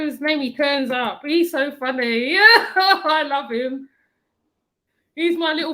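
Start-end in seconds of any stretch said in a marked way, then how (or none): 2.3-3.9 loud, slow laughing affection; laughing fondly. Real, not acted. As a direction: laugh it out with love. Laughing fond remark.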